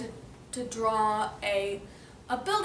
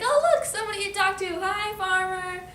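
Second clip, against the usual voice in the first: high, loud, delighted pig; excited pig greeting; 8 semitones higher, 6 dB louder than usual. Acted. Pig spots a farmer to talk to, high sing-song excitement. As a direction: call out gleefully, drawing out the greeting.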